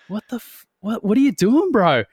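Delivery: high, puzzled cry of disbelief; baffled mock outburst. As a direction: act out the baffled guys. Other flyers puzzled at him.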